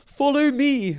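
high, loud call to flee; urgent cry. A narrator's reading. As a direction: shout it as you run for safety. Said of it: "cried his cousin".

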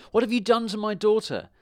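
high, loud demand from a father; outraged shout. A narrator's reading. Farmer demanding answers about his daughter, shouting. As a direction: shout the question, pitched high. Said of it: "shouted the farmer".

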